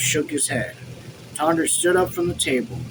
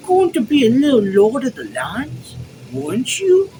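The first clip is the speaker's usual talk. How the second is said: mocking imitation; 10 semitones higher, 6 dB louder than usual. Officer apes Tanner's dream of farms, high mocking imitation. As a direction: mimic him mockingly, pitched way up. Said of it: "he imitated Tanner's voice".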